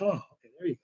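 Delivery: soft, hushed relief as the pain lets go; relieved sigh. Retold or imitated. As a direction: breathe it out quietly like sudden relief. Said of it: "I was like".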